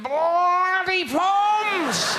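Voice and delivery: high, drawn-out outraged shout of Bloody Poms; mock Aussie yell. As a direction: bellow it like a furious Australian fan. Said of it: "They'll be going".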